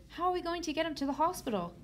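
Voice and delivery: high-pitched, anxious exclamation; panicked mother. A narrator's reading. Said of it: "yelled Loretta's mother".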